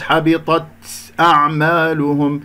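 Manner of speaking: "حبطت أعمالهم" with a long exaggerated hiss on the ت of "حبطت"; over-breathed ت. Shown as a demonstration. Teacher showing a recitation mistake, ت of "حبطت" over-aspirated. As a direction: overdo the puff of air ending "حبطت" before "أعمالهم". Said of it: "أو أبالغ في الهمس".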